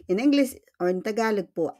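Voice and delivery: ordinary delivery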